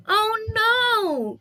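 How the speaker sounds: high, loud oh no; dismayed cry. Acted. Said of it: "cried Percy".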